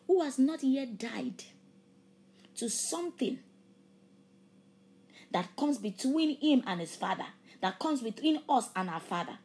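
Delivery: loud, fervent preaching that stretches key words; impassioned sermon climax, drawn out and loud. Acted. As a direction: preach it with full force to the congregation. Preaching at full force again.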